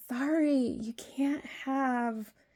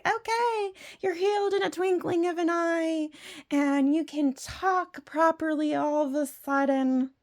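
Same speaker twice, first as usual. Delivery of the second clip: much higher, mock divine announcement; the Lord speaking; 8 semitones higher than usual. Sceptic mocking the Lord's instant healing, high mock voice. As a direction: play the Lord granting an instant miracle, lightly mocking. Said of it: "the Lord is just gonna ... be like".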